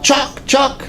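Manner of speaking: high called-out name, mock yell; a high-pitched guide's call; imitating guide's call. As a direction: call his name from across the hillside. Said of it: "the guide's yelling".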